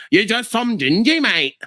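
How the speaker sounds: high, mocking Australian twang; Australian accent, mocking and played up for laughs. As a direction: imitate a cheeky Australian trash-talker. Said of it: "You guys talk to me in the way you".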